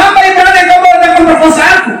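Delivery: high, rushed mock accusation; accusing wife. Preacher imitating Potiphar's wife again for laughs, high mock accusation. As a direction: play the indignant wife, high and quick. Repeats the wife's acted accusation.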